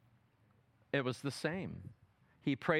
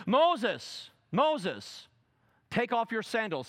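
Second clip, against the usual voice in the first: higher, louder call; 6 semitones higher, 5 dB louder, slower than usual. Acted. God calling to Moses at the bush. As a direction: summon him from the bush.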